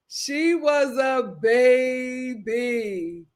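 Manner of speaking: very slow, each word stretched long; drawn-out emphasis. Real, not acted. Host excuses the young girl, drawn out for emphasis.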